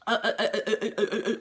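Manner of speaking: rapid, stuttering string of hesitations; scared stammer. Retold or imitated. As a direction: imitate being too shaken to get a word out. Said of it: "I'm shaking".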